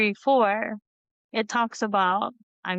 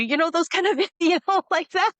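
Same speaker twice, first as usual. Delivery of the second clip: high, louder words through real laughter; laughing; 8 semitones higher, 3 dB louder, faster than usual. Real, not acted. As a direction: laugh it out as you speak. Laughing at the ironic lyrics.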